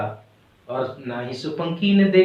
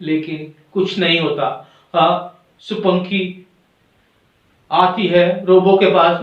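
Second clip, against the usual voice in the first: usual speaking voice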